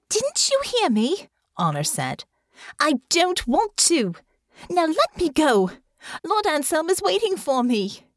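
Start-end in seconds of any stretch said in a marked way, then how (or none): 0.1-1.3 voiced young girl. Honor protests being led off. 2.8-8.1 put-on higher, stubborn girl voice; a defiant young girl pulling away. Honor refuses to go.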